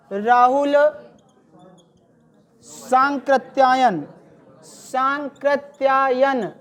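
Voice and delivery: Sankrityayan stretched slowly syllable by syllable; slow corrective dictation. Shown as a demonstration. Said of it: "आज के बाद गलत ना करिएगा". (धीरे-धीरे सही नाम बताना)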